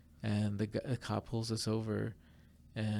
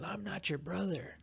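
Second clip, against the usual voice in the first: higher put-on voice of a curt cop; gruff cop; 8 semitones higher, 3 dB quieter than usual. Cop snapping at the waffle vendor, mock gruff. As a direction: bark it like a touchy cop. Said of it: "he turns around and he goes".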